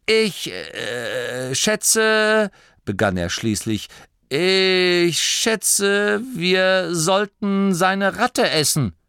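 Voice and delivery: a dithering chief finally speaks; hesitant old leader. A narrator's reading. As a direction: drag it out uncertainly. Turret hesitantly decides.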